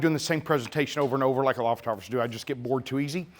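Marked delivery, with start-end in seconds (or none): none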